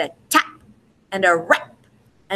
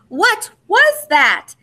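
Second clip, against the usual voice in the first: high, slow, scared cry; frightened call into the dark; 10 semitones higher, 4 dB louder, much slower than usual. A narrator's reading. Three bears calling out in the dark, high and slow with fright. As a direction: stretch it out like startled little bears. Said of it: "they called out in the darkness".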